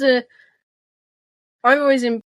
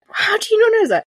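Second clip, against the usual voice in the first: mock shocked friend; 11 semitones higher, 3 dB louder than usual.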